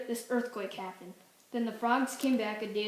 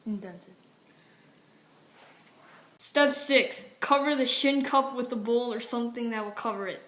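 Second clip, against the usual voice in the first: ad read; 7 dB louder than usual. Acted.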